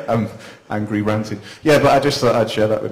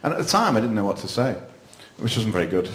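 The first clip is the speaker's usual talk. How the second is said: ordinary talk, nothing marked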